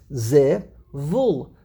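zivul split slowly as zi, pause, vul; two separate syllables. Shown as a demonstration. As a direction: count out both syllables of zivul. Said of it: "There are only two syllables".

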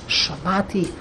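soft, sheepish recitation; embarrassed undertone. Acted. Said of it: "in an undertone".

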